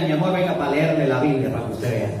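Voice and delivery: butter her up gently; mock sweet coaxing. Husband sweetly inviting his furious wife to hear the Bible.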